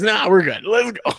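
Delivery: carefree barfly, pitch jumping up; dismissive drinking buddy. Retold or imitated. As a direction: wave away the worry and urge them on. Ricky waves off objection.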